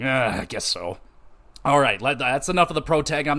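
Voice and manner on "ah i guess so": put-on low, grudging old-man grumble; gravelly old blacksmith. Blacksmith agreeing to craft a blade, grudging low gravel. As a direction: imitate a gruff smith giving in reluctantly. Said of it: "this old gravelly voice".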